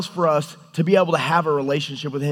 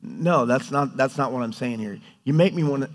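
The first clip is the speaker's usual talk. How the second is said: lower, gentle correction after the outburst; calm God; 4 semitones lower, 4 dB quieter than usual. Acted. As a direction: calmly set them straight, without anger. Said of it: "he's not like us".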